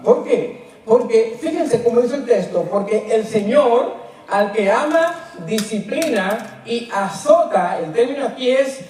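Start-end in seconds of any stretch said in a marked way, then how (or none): none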